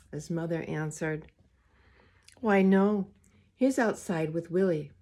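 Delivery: put-on gentle read-aloud to children; story reading. A narrator's reading. Reader to the children, storytime voice. Reads picture book aloud.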